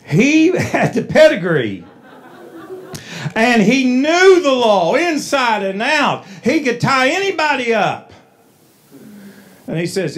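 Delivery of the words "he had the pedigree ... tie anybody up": preaching. Acted. Preacher at full force.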